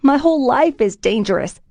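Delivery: high, loud defiant shout. A narrator's reading. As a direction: yell it back. Shouting match continues.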